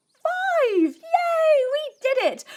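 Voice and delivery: high excited cheer; bright high yay. Pretend. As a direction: celebrate with the kids. Counting ends in cheer.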